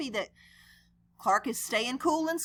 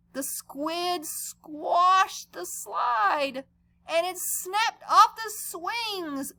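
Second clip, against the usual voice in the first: dramatic slow narration; 7 semitones higher, much slower than usual. A narrator's reading.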